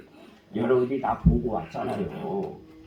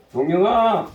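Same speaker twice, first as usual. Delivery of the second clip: a louder call across the yard; 6 semitones higher, 7 dB louder than usual. Retold or imitated. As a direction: call his name to summon him. Brother calling him. (형이 동생을 부르는 외침)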